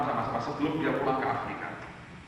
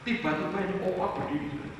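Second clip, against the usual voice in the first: ordinary delivery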